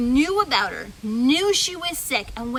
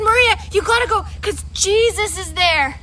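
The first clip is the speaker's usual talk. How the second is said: higher and louder pleading; persistent kid; 5 semitones higher, 7 dB louder than usual. Acted. Kid urging Maria to go see Jesus. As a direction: press her to go. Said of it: "He was so persistent".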